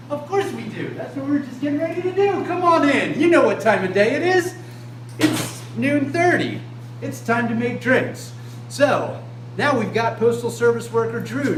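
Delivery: rally everyone to start drinking; overbright party host. Acted.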